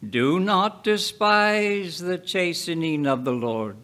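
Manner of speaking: ordinary talk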